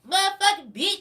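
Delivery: high, loud furious scream; screaming neighbor. Retold or imitated. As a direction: shriek it at the top of your lungs. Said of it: "she's screaming on the top of her lungs".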